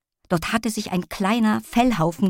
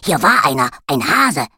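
loud, eager puppy on a scent; excited dog voice; 2 semitones lower, 5 dB louder than usual. A narrator's reading. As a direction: sniff out the trail, all excited. Dog character sniffing around.

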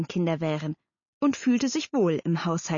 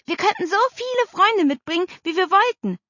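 high, louder, carried away; excited child; 11 semitones higher, 4 dB louder than usual. A narrator's reading. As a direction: get carried away daydreaming aloud. (begeistertes Kind, hohe Stimme)

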